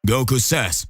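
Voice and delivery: plain reading voice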